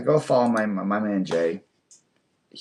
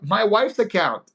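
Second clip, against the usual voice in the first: laughing, higher voice; amused; 9 semitones higher, slower than usual. Real, not acted. Reading a viewer's funny account name, amused. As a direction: read it through a laugh.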